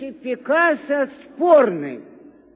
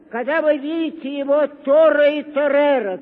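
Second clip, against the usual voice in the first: ordinary talk, nothing marked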